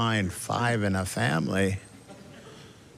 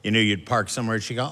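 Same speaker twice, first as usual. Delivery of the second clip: normal talking voice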